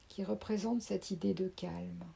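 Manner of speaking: usual speaking voice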